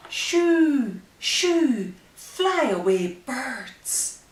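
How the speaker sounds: loud, high shoo-ing call; playful shoo. Acted. Reading to a baby, loudly shooing the birds away.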